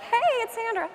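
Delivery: very high, put-on singsong hello; mock your own perky camera greeting; exaggerated high-pitched introduction; video take. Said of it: "takes of like".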